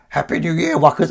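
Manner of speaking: chirpy cartoon greeting for the new year; cartoon character voice. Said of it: "Oh, that's a really good voice".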